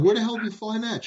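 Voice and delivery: high, fast, stunned outburst; astonished disbelief. Retold or imitated. Husband stunned at wife's battlefield find, high and fast disbelief. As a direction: blurt it out, amazed at the find.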